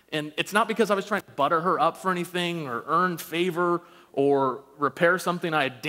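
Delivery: ordinary delivery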